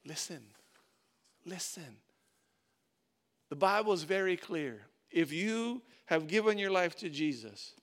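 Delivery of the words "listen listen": soft calling. Retold or imitated. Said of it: "where God says".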